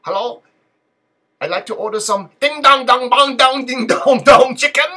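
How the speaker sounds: loud, high-pitched mock restaurant customer; strange prank-call voice. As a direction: put on a silly high voice, building to a loud ding-dong chant. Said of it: "a strange voice".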